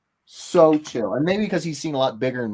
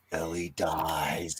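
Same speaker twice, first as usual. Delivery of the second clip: slow, low whisper, dropping the bad news; flat whisper; 9 semitones lower, 7 dB quieter, much slower than usual. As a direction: hush it out, slowly, like a gut punch. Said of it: "they're like".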